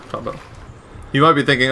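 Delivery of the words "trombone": settled, quiet. Real, not acted. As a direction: mutter the answer, decided. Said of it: "Maybe not trumpet".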